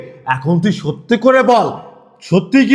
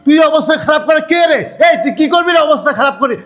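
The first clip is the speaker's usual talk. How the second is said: mocking shout; 6 semitones higher than usual. Angry quarrel, shouting mockery.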